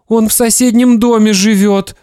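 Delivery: put-on high child voice near tears; tearful boy. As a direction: answer dad, about to cry. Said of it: "и заплакал".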